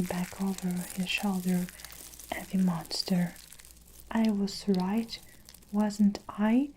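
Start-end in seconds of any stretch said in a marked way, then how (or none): none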